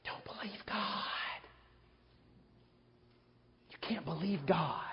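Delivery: slow breathy whisper of the tempter; hushed tempting whisper, slow; Satan whispering. Acted. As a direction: whisper it like a serpent in the ear. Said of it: "he whispers".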